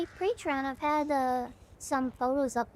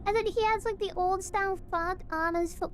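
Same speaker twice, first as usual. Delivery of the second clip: higher, cooing delight; gushing over cuteness; 6 semitones higher than usual. Real, not acted. Streamer spotting the demon's cute phone bling, gushing.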